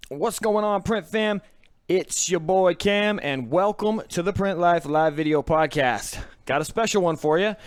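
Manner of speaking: big stretched-out livestream welcome; podcast intro. Acted. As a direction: greet the audience with drawn-out, emphatic host energy.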